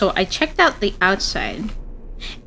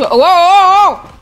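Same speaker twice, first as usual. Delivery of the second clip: loud, higher cry of surprise; startled whoa; 7 semitones higher, 7 dB louder, slower than usual. Real, not acted. Gamer reacting to a sudden shock, startled and loud. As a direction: let out a big, loud whoa.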